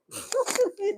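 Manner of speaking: short high laugh, falling away; quick burst of high giggling; real giggle. Real, not acted. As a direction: crack up at your own joke. Laughs at her own joke.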